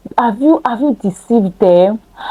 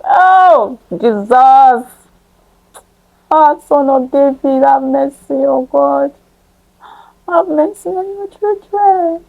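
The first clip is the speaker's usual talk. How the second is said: pleading prayer; 4 semitones higher, slower than usual. Real, not acted. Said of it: "spontaneous prayer".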